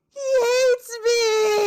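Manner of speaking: slow, loud, hammed-up crying shout; mock wail. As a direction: overplay a sob. Player wails as character.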